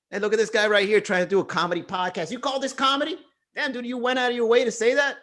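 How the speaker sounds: high, fast heckling troll voice; mock heckler. Troll heckles his comedy podcast, high jeering voice. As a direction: jeer at the comedy podcast, pitched well up. Voicing a troll mocking him.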